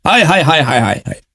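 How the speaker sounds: low, falling sighs of dismay; lamenting interjection. Real, not acted. Debater lamenting after quoting a grim prophecy, low dismayed muttering. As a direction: let the lament sink and fade away.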